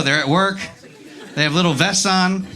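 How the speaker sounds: ordinary talk, nothing marked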